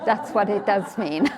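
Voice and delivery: amused, laughing confirmation. Real, not acted. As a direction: say it while breaking into a laugh. Laughs at own blunt answer.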